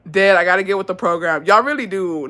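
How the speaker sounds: ordinary talk, nothing marked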